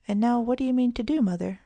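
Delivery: a lifted, keen child's question, lightly acted; eager question. A narrator's reading. Girl asking her mother what she means to do, eagerly. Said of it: "Kitty asked eagerly".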